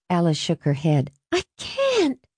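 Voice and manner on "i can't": put-on very high-pitched girl's plea; distressed refusal. A narrator's reading. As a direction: balk in a high, helpless voice. Daughter refuses, near tears.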